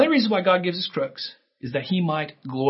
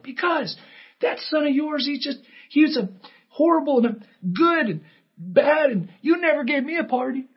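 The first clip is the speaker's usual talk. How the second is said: whiny sulking son; 10 semitones higher than usual. Retold or imitated. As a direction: whine like a jealous older brother.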